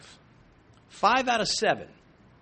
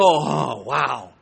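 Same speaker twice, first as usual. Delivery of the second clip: low, loud, drawn-in "oh, wow"; mock being struck with wonder, voice dropping; jaw-dropped awe; 4 semitones lower, 5 dB louder than usual. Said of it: "drop my jaw down and go".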